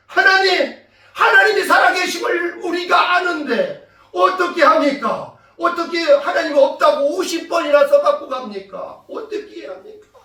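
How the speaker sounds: loud, high pleading, easing off at the end; earnest prayer. Retold or imitated. Mother and child pleading with God, acted earnest cry. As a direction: cry out imploringly, imitating desperate prayer. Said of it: "간절히 기도했습니다".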